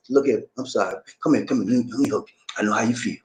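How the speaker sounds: gently gather someone in to console them; comforting. Acted. Strong leader comforting his hurting people, soft consoling voice. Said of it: "the one to comfort them".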